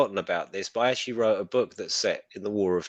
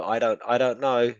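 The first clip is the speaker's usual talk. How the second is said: normal talking voice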